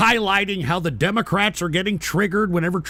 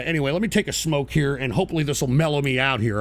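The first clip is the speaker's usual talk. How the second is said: usual speaking voice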